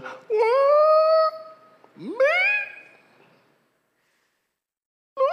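shocked. Retold or imitated. Pastor getting an unexpected job offer, high shocked squeal. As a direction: squeal of disbelief. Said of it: "retelling a surprise job offer".

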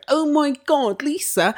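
high, put-on hybrid American accent; mock an odd affected transatlantic accent; Dorit. Podcaster imitating a housewife's odd accent, high and affected. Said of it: "whatever that fucking accent is".

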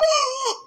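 high, sharp collective-style gasp; shocked gasp. Retold or imitated. Whole church hearing her blunt testimony, shocked gasp. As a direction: suck in air in mock shock. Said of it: "the whole church went".

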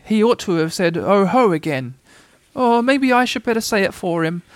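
mildly anxious inner thought. A narrator's reading. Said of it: "thought Piglet anxiously".

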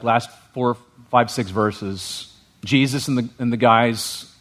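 normal talking voice

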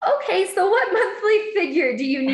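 high, loud, laughing through the question; laughing quote. Retold or imitated. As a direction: giggle while quoting her. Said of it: "She was like".

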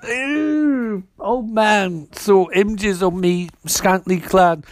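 high, drawn-out "ew", then slow dismay; mock disgust. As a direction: squeal the ew in exaggerated revulsion. Retold disgust at shared memory.

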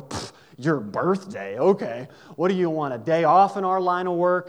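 loud, scoffing teasing of a friend; mocking tease. Diner girls mock Andrea's birthday wish, loud scoffing tease. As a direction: laugh it off at her, scoffing and loud.

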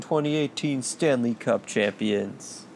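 normal talking voice

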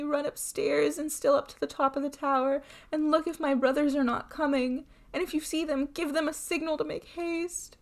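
high-voiced, anguished appeal; desperate pleading. A narrator's reading. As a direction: plead with your sister as time runs out. Terrified wife begging sister for help.

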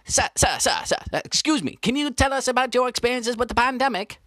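ordinary talk

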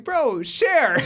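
hammed-up pleading to a friend; mock plea. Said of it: "It's like".

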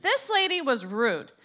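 normal talking voice

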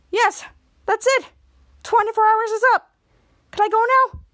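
high-pitched, eager outburst; excited retelling. Retold or imitated. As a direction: act out eager relief, voice lifted high. Acted quote, grounding is over.

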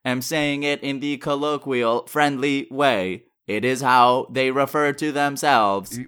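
robotic synthesized speech, evenly paced; computer voice. Acted. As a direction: speak like a talking computer.

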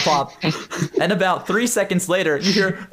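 ordinary talk

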